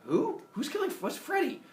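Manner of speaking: high, baffled questions; confused surprise. Acted. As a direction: sputter in confusion. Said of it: "Minos goes".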